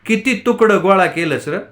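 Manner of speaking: wife mocks begging husband, exasperated; irritated and loud. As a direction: fling it at him in irritation. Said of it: "तिडीक आल्यासारखी मोठ्यांदा म्हणाली".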